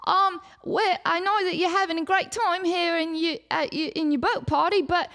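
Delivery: formal clipped bureaucrat; nerdy intern. Retold or imitated. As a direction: intern addressing the drunk explorers. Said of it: "he's like".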